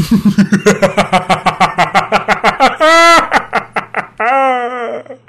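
long, high, crazed evil cackle; evil laugh. Pretend. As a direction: cackle like a scheming villain. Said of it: "Ganz böse und teuflisch".

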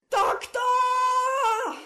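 drawn-out, very high scream, muffled as from afar; hysterical scream. A narrator's reading. Rika screams for the Doctor as the floor collapses, hysterical. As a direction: scream for help, held long but kept soft. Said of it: "schrie Rika hysterisch".

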